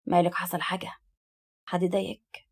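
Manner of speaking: soft, low concerned murmur; whispering. A narrator's reading. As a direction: lean in and ask very quietly. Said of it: "مايل عليها بهمس". (بهمس)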